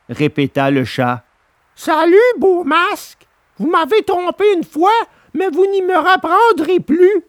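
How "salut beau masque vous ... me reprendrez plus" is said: high puppet voice, cheeky farewell; Pinocchio mocking. A narrator's reading. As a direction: brush off the swindlers. Pinocchio rebuffs fox and cat.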